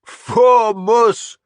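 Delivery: high, loud chant-like shout; the whole line taking up the cry; men shouting war cry. A narrator's reading. Laughing warriors echoing the war cry, loud shout. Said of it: "they shouted".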